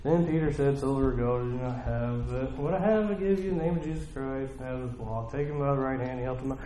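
soft, low, monotone rote reading; stuck Bible reading. Acted. Reader stuck in the Bible, droning under the breath. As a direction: read the verse quietly as if to yourself. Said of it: "stuck reading your Bible and you're like".

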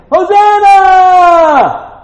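long, high, loud ringing cry of Hosanna; joyful shout. Retold or imitated. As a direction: shout Hosanna like a cheering crowd. Crowd shouting at Jesus' arrival.

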